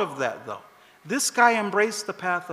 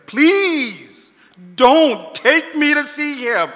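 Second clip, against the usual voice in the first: louder, slow pleading for mercy; old man begging the guards not to take him, louder and slow; 7 semitones higher, 8 dB louder, much slower than usual. Retold or imitated. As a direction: plead slowly, scared of being taken. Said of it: "The guy starts begging them".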